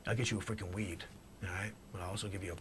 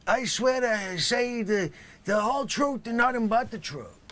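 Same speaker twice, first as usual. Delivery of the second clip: overblown oath; 11 dB louder than usual. Acted. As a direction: bellow the oath like a bad actor. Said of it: "not quite good enough there".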